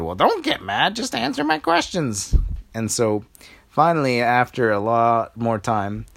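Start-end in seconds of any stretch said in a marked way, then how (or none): 0.0-2.3 put-on high, scolding old lady voice; tut-tut them like a fussy granny. Old lady scolding the princesses, high and fussy. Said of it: "the old lady said".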